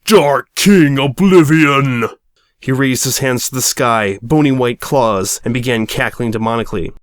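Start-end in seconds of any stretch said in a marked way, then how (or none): 0.0-2.1 slow, loud dramatic proclamation of his name; grand name reveal. Acted. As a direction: drag out the name for maximum effect. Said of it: "He paused for dramatic effect".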